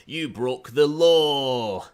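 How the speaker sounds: LAW held long and loud; drawn-out LAW. Shown as a demonstration. Showing how Scots say law, LAW stretched loud. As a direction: stretch the vowel of "law" right out. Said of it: "tell the difference when you say law".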